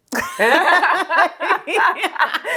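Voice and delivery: high giggling laugh she can't hold back; laughing too hard to get the words out; burst of giggles. Real, not acted. Hesitant guest laughing before saying something awkward. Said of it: "Spit it out".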